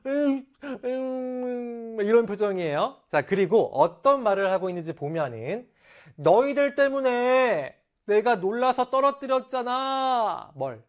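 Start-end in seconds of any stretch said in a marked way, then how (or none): 0.1-2.0 high, drawn-out sulky "mmm"; wronged child's whimpering grumble; aggrieved pouty whine. Acted. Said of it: "굉장히 막 억울한 표정이에요". 6.2-10.5 high, drawn-out pouty complaint; aggrieved protest. Acted. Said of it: "너무 안타깝고 억울한 겁니다".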